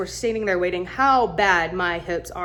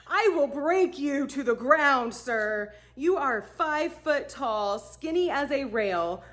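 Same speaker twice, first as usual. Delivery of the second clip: slow, softer, spelled-out protest; slow, emphatic refusal; 5 semitones higher, 4 dB quieter, slower than usual. Retold or imitated. Woman refuses tiny guide's offer, slow emphatic protest. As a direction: spell it out slowly, word by word.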